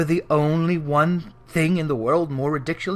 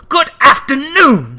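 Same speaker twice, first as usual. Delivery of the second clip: loud, higher, slow final dismissal; a loud, slow, stern goodbye; curt dismissal; 9 semitones higher, 10 dB louder than usual. Acted. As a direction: slam the door with the words. Scrooge dismissing his nephew.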